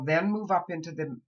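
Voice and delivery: usual speaking voice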